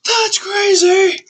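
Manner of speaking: a sudden high, loud yelp of disbelief; real startled squeal; shocked shriek. Real, not acted. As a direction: shriek it out, genuinely freaked. Reacting to creepy story ending.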